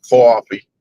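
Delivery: "coffee" as "cawfee", Massachusetts style; Massachusetts accent. Retold or imitated. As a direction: imitate a friend's Massachusetts "coffee". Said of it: "coffee with that Massachusetts accent".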